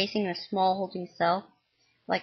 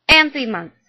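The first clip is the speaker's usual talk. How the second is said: louder, higher sharp retort; 6 semitones higher, 10 dB louder than usual. Acted. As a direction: snap it back at him. Mom snaps back.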